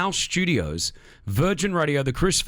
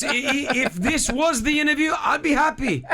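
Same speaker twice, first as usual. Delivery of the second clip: laughing; 11 semitones higher than usual. Real, not acted. Host laughing through words.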